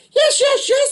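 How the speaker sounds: put-on high, eager little boy's voice; a small boy pleading yes. A narrator's reading. Little boy promising the fisherman, high and eager. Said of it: "the little boy promised".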